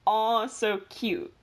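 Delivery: slow, deliberate mock-formal label; slow formal naming. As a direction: spell it out like a scientific name. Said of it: "all under the family".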